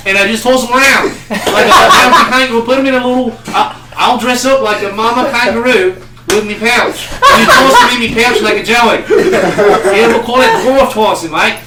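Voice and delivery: loud, high laughing Australian bloke, fast in places; over-the-top Australian accent, laughing. Retold or imitated. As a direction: ham up an Aussie pub mate. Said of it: "to think that they're like "Oi".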